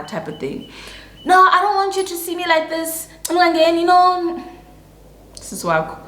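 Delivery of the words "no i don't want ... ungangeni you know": high, whiny mock protest; theatrical sick act. Keeping him out of the bathroom, hammed-up protest. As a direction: play up being too ill to be seen. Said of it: "the theatrics".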